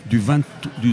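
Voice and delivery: normal talking voice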